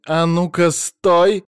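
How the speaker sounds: loud, high-pitched shout to halt; yelling. A narrator's reading. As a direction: yell to stop him hanging up. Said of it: "заорала женщина". (орёт, требуя остановиться)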